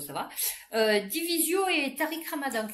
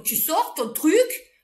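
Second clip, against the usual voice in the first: slow, loud and higher, hammering each word; yelling at him; 6 semitones higher, 6 dB louder, much slower than usual. Retold or imitated. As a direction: bellow it at the old man in the street. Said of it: "et je gueulais dans la rue". (crier lentement sur le vieux qui l'accuse)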